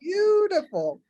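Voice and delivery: high, breathless mock admiration; a sarcastic ooh; mock gushing. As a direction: gush like an impressed tourist, tongue in cheek. Mocking gushing about beautiful place.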